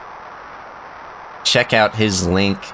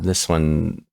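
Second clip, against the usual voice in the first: ordinary delivery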